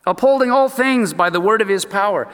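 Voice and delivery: ordinary talk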